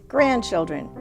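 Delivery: plain reading voice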